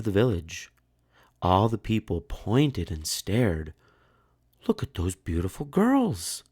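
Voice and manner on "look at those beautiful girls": high, awed voice of the crowd; admiring villagers. A narrator's reading. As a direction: gasp in admiration at the girls. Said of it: "they said".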